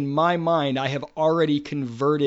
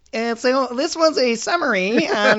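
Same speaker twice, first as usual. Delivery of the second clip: high, loud put-on goofy voice; silly sign-off voice; 10 semitones higher, 6 dB louder than usual. Host jokes about ending the podcast, silly mock sign-off voice. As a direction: wrap up in a ridiculous pitched-up voice. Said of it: "shouldn't end this podcast with, like".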